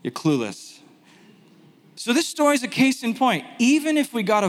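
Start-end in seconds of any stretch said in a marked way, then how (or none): 0.0-0.8 lightly voiced rebuke, dropping low; understated correction, sinking lower and softer; Jesus. Jesus tells the arguing crowd they missed it, low mild rebuke. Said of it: "And Jesus is like".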